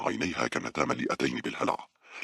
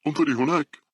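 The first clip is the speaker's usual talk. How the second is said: high, louder exclamation; startled cry; 13 semitones higher, 5 dB louder than usual. A narrator's reading. Paula spots someone behind them, startled cry. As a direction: call out in high-pitched alarm. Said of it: "صحت باولا حين رأته".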